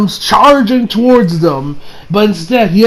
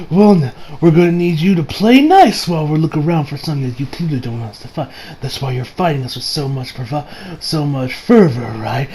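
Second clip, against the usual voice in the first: low, soft, controlled acted threat; calm menace; 4 semitones lower, 4 dB quieter than usual. Retelling Jotaro warning the captured photo, calm quiet menace. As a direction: sink lower and quieter as you go, coolly threatening.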